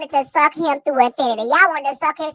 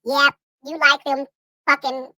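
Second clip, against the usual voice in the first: ordinary talk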